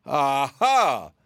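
loud, gruff aha of discovery; hearty cry. A narrator's reading. Captain General spotting the Winkie border, a loud cry. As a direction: bark it out like an old soldier. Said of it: "cried the Captain General".

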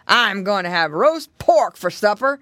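loud, menacing bear voice; big greedy bear; growling bear. A narrator's reading. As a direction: boast hungrily as a storybook bear. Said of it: "growled the bear".

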